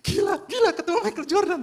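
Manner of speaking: high, loud squealing disbelief; excited squeal. Retold or imitated. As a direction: shriek it like a starstruck fan. Retold shock at meeting a star.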